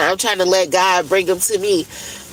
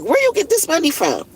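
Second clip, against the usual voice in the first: mama questioning; 7 semitones higher than usual. Retold or imitated. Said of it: "my mama would be like".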